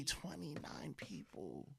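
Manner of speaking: slow stunned whisper. Real, not acted. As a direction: breathe the number out in shock. Stunned at death count.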